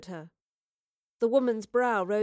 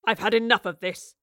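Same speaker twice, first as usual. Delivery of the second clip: loud, gruff impatience; angry, forceful grunt; 6 dB louder than usual. A narrator's reading. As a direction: grunt it out, fed up. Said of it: "Rivers grunted".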